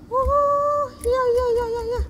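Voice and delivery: put-on high childlike nodding along; a child eagerly agreeing; little girl agreeing.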